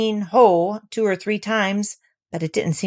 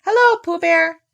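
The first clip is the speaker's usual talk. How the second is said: cheery greeting; 10 semitones higher, 7 dB louder than usual. Acted. Child greeting Pooh.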